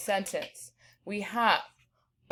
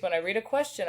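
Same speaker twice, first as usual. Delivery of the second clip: usual speaking voice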